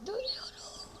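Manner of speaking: breathy whispered aside; whispering. Real, not acted. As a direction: whisper it to yourself. Quietly announcing turn.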